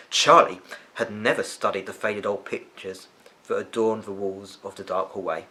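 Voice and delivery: ordinary delivery, nothing marked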